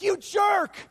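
high, loud mock yell at a friend; playful retold shout of "you jerk"; mock outraged shout. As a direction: yell it like you've been tricked, half joking. Retold outburst at tricky friend.

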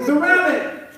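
high, loud comic panic; mock scream. Neighbor discovers the returned rabbit, mock screaming. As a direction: cry out in hammed-up shock. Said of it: "the neighbor screams".

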